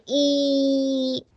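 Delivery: "E" as a very long, level ee; sustained pure ee on "E". Shown as a demonstration. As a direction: hold "E" out slowly. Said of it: "都是E".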